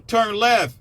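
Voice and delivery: high, loud shouts of warning, pulling up over and over; shouted warnings. Retold or imitated. Warning listeners before the crash, shouting high and loud. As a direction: yell it like a panicked co-pilot. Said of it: "I said".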